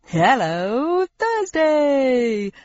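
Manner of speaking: slick, beaming TV host; game show host voice. Acted. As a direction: greet her like a cheesy quiz host. Game show host greets Thursday.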